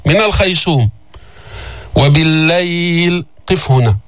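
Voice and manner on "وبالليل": slow, measured chanting of the verse; Quran recitation. Shown as a demonstration. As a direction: recite it slowly with a heavy raa. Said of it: "teacher recites verse".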